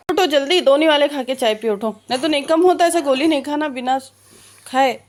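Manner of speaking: rousing a sleepy husband, loud and insistent; coaxing call. Real, not acted. Wife waking her sick husband, calling loudly.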